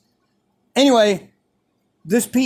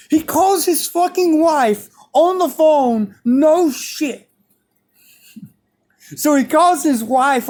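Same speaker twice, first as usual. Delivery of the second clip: high-pitched, emphatic disbelief; incredulous emphasis; 7 semitones higher, 3 dB louder than usual. Real, not acted. Storyteller stressing coach really phoned his wife, incredulous. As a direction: marvel at how absurd it was.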